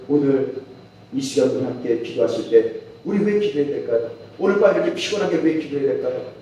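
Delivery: ordinary talk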